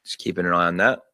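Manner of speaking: ordinary delivery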